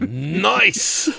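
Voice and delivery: loud, high delighted exclamation; excited. Real, not acted. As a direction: burst out delighted. Delighted at the wife's beads.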